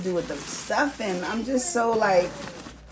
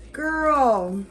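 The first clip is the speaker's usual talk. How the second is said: ordinary talk, nothing marked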